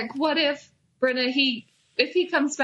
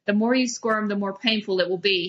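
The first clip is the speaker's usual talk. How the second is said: ordinary delivery, nothing marked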